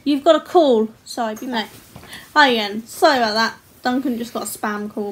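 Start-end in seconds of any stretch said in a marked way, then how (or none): 0.0-0.9 calling out. Real, not acted. Telling partner about phone call.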